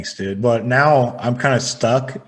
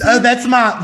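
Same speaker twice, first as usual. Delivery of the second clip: put-on feminine Southern accent; 10 semitones higher, 6 dB louder than usual. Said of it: "my feminine Southern man accent".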